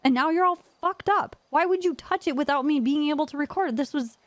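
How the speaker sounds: high-pitched retold rant; exasperated telling-off. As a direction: act out chewing out a friend.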